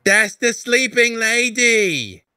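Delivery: put-on high, slow child's voice; a small child pointing up in wonder; little girl's voice. Little Amelia pointing at the lady from the street, high child voice. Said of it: "you hear a little voice say".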